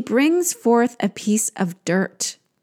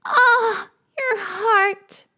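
very high wailing sigh; echoed sigh; 11 semitones higher, much slower than usual. Acted. Creatures echoing the queen's heartache, sighing very high. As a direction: sigh back in high lament. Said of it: "They sigh".

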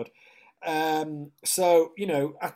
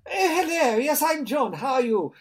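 much higher, louder, plummy switched-on greeting; suddenly posh actor voice; 13 semitones higher, 10 dB louder than usual. Retold or imitated. Actor greeting the casting director in a sudden posh voice. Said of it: "Why is he talking like that?".

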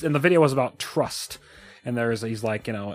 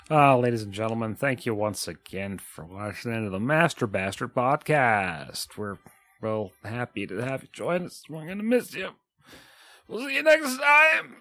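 mock announcer outro. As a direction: play the cheesy host closing the show. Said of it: "podcast outro".

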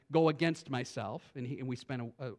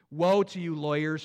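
stern rebuke; 6 dB louder than usual. Acted. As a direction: thunder the woe. Reading Jesus' rebuke.

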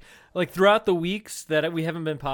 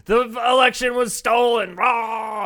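higher, louder hammed-up shout; mock alt-right pundit; 6 semitones higher, 5 dB louder, slower than usual. Joking how to get viewers: mock pundit shout. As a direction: parody a ranting pundit. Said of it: "we need to be angry and alt-right".

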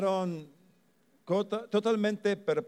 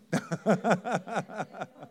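short laugh; 4 semitones higher than usual. Real, not acted. Laughs at own joke.

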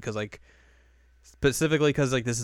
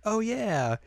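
high, airheaded realization; Yui remembering; 12 semitones higher than usual. Retold or imitated. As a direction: imitate a girl who just remembered. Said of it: "She's like".

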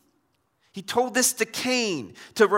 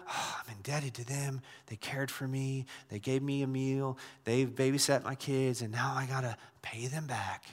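much softer, lower, weighed-down voice; burdened indebtedness; 5 semitones lower, 8 dB quieter than usual. Acted. Preacher voicing someone who feels they must repay kindness, burdened and soft. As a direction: sigh it out like a debt you owe.

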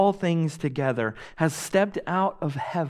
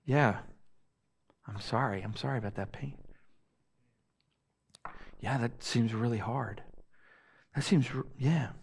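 low, soft, distracted murmur of sympathy; mock a listener who is barely engaged; awkward, trailing-off condolence; half-hearted sympathy; 5 semitones lower, 8 dB quieter, faster than usual. Acts out half-hearted listener to pain.

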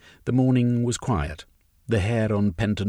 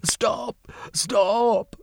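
high shouted stop; yelling. A narrator's reading. Diamone yells at the fleeing workers to stop. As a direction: call the fleeing crowd back. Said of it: "Diamone yelled".

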